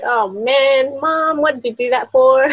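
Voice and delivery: mock whiny kid protest; playfully voicing a daughter's groan; a hint of a grumbling child; daughter's complaint. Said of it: "she's like".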